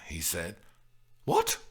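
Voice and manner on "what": very high astonished question; high-pitched amazed exclamation; baffled amazement. A narrator's reading. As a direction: squeak it out, utterly baffled. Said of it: "in baffled amazement".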